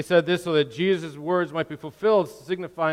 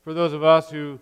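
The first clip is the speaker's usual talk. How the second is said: prayer. Acted. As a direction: pray it to the room, humbly. Closing prayer after reading.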